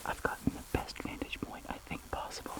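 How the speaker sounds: hushed whisper, sneaking up on wildlife. Real, not acted. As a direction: whisper so the bird stays put. Sneaking close to feeding eagle.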